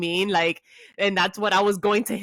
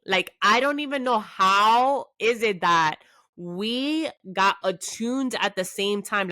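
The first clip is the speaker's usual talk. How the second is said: ordinary talk, nothing marked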